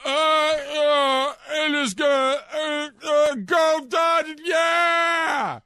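high, loud slurred yelling; drunken celebratory shout. Retold or imitated. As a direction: bellow it like a drunk fan. Imitating drunk Scherzer celebrating.

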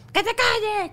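a hint of an angry mother snapping; imitating a strict mom, not meant; mock yell. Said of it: "Entonces yo no soy de".

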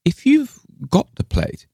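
high, pressing tone; insistent. A narrator's reading. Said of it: "she insisted".

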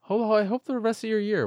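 normal talking voice

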